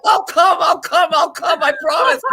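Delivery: high, loud, wailing mock sob; mock crying Gino. Mocking Gino begging Jasmine, high wailing mock sob. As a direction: ham up a blubbering begging man. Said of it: "I see Gino just in tears".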